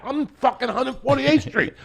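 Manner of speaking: high-pitched, worked-up storytelling shout; comic neighborhood bravado, voice pushed high; fired-up bravado. Retold or imitated. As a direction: crank it up like a hyped kid. Acting out his fired-up bravado.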